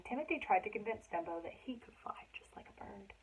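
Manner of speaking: soft whisper all through; whispered reading. Acted. Reading to children at bedtime in a whisper. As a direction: hush it like a bedtime secret.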